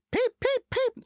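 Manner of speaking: very high, loud peep peep; cheeky whistle. A narrator's reading. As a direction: toot back cheekily. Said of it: "whistled Thomas".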